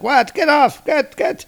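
high, loud panicked shout; startled yelp. Retold or imitated. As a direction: shake it off in alarm. Spear liquid flows onto Gore.

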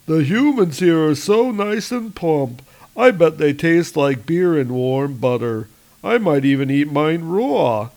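a troll enjoying the raid; gleeful troll. Acted. Troll savours humans.

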